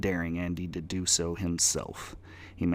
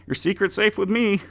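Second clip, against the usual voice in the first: much higher, loud and eager; eager reassurance; 8 dB louder than usual. Acted. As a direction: reassure the boss too eagerly. Clerk reassures demon boss.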